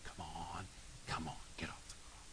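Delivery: soft tempting whisper, almost no voice; Satan's whisper. Acted. Satan tempting Jesus on the cross, whispered. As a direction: breathe it out like a tempter. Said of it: "Satan says".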